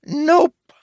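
high, loud, cheeky cut-in; grinning interruption. A narrator's reading. Red Baron interrupts the merchant again, grinning. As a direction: grin as you cut them off. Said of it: "said it again with a grin".

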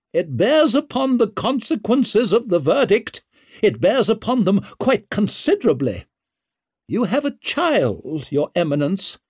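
high, insistent lady's voice; imperious noblewoman. A narrator's reading. Noblewoman pressing the judge over the verdict, pointedly insistent.

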